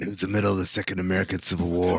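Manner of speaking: low, quick movie voiceover; voiceover internal monologue. Acted. Character setting up the story, low voiceover monologue. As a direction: narrate like a film's inner monologue. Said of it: "Voiceover internal monologues position".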